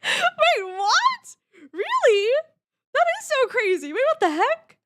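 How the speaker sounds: high, loud astonished chatter; excited disbelief. Real, not acted. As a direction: react with loud amazement. Reacts to surprising news.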